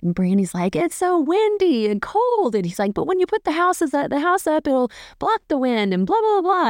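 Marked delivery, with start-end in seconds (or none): 0.7-2.5 high, slow complaining voice; high mock whine of a friend put off by the wind; mock complaining Brandy. Brandy quoted complaining about land. 2.9-6.7 much higher, eager pitching voice; high hammed-up eager friend talking up a house; excited Mark impression. Mark quoted, excited about building.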